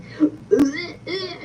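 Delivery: a hammed-up sobbing gasp; mock crying gasp. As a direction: gasp like a man faking a breakdown. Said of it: "doing his big one".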